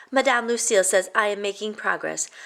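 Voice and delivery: plain reading voice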